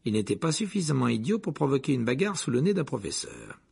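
usual speaking voice

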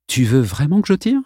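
lightly innocent question; a boy feigns innocence to the bully. A narrator's reading. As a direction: ask it all innocence. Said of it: "demanda-t-il d'un ton innocent".

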